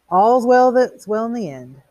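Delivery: high, loud, slow pronouncement; grand flourish. A narrator's reading. Beatty closing his torment of Montag, grandly triumphant. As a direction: draw out the maxim triumphantly.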